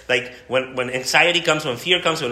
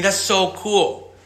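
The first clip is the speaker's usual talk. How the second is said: ordinary delivery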